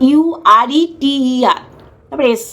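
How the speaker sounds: ইউ আর ই টি ই আর, letter by letter; spelled out. Shown as a demonstration. Host proving the term, spelling ইউ আর ই টি ই আর aloud.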